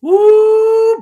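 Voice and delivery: long, loud high "woo"; amazed whoop. Real, not acted. Hearing of a very old relative, amazed whoop. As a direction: let out a big held whoop. Cheering a great uncle's age.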